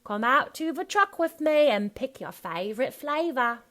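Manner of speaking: loud, high Cockney invitation; Cockney accent. Shown as a demonstration. Coach luring puppies to the truck in character, Cockney accent. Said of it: "about Cockney".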